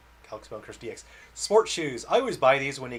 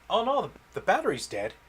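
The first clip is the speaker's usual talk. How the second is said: higher alarmed oh no; surprised; 7 semitones higher, 3 dB louder than usual. Real, not acted. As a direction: react with sudden dismay. Battery dies mid-stream.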